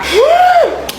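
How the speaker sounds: sharp high gasp; shocked gasp. Acted. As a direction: gasp as if caught off guard. (gulat na hingal)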